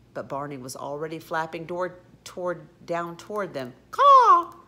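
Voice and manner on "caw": very loud, high parrot screech; alarm-bell bird squawk; parrot caw. A narrator's reading.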